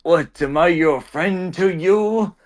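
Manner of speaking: slow, high, badly faked Russian accent; attempted Russian accent. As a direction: question them in a clumsy Russian accent. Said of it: "tried to do a Russian accent".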